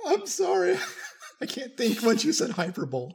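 high laughing apology; can't stop laughing while apologizing. Real, not acted. Laughing fit over a word.

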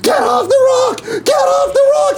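frantic high shout of a warning; locals yelling. Retold or imitated. Locals on the rock screaming warning at swimmers, frantic shout. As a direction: yell it up high like panicked locals. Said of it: "They're like".

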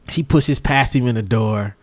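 usual speaking voice

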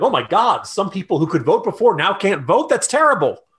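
exaggerated shock at bad news; mock alarm. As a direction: play the horrified headline reader, voice jumping up. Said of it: "you're like".

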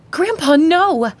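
high, drawn-out cry of alarm; shouting. Acted. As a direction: yell it to stop him. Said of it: "Carrie shouted".

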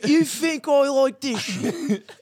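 hammy fake British accent, slowed down; clumsy impression of a British gangster; switching accents clumsily. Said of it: "What even accent is that?".

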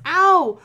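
sharp high yelp of pain. Acted. Unicorn yelps at a pothole hitting her.

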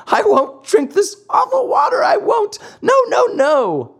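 put-on very high, loud childish wail; shrieked tearful puppet tantrum; crying tantrum. A narrator's reading. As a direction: shout the refusal like a bawling child. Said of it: "bursting out crying".

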